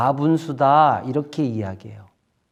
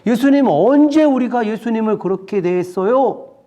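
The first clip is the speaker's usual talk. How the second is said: raised, louder acted complaint; acted angry questioning, pitched up and loud; indignant protest; 6 semitones higher, 5 dB louder than usual. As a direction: demand an answer like an offended crowd. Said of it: "막 화를 내면서 막 따져 물었어요".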